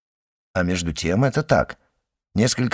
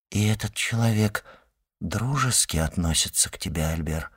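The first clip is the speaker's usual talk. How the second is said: softer, understated anxious question; 2 semitones lower, 6 dB quieter than usual. A narrator's reading. Mother asking her son about his new friend, quietly anxious. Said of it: "с волнением спросила она".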